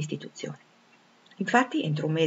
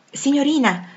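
louder, higher call for attention; 8 semitones higher, 6 dB louder than usual. A narrator's reading. An inserviente hails Jane in the corridor, calling out. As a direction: call out to get her attention. Servant calls out to her.

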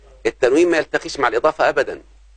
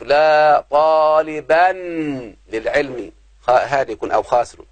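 slow drawn-out example; much slower than usual. Shown as a demonstration. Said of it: "شوف".

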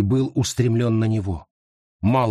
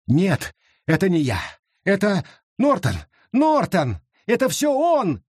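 climbing higher, frantic; panicked pleading; 7 semitones higher than usual. Acted. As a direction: shift the blame in panic. Garrett panics, blames Norton.

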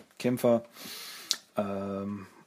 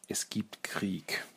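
hushed ominous whisper; 6 dB quieter, much slower than usual. Retold or imitated. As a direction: breathe it out like a dark secret. Donatello translates the ominous words.

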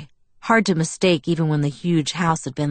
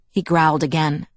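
plain reading voice

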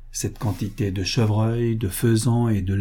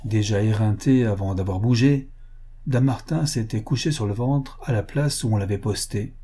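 usual speaking voice

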